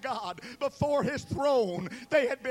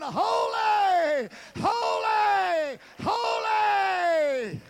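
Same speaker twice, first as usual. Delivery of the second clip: high, loud, drawn-out holy; exalted cry; 6 semitones higher, 4 dB louder, much slower than usual. Acted. Seraphim crying out before the throne, high and loud. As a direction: cry each holy out like an angel.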